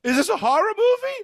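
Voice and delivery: high, light imitation of a rattled man; startled stranger. The man in the theater asking, lightly voiced high. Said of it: "He goes".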